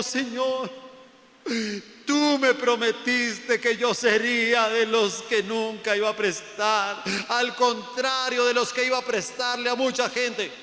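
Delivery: high, loud, slow mock kneeling plea; pleading believer. Preacher imitating a debtor begging God on his knees, hammed-up pleading. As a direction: whine to God on your knees. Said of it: "los ve uno hasta arrodillado".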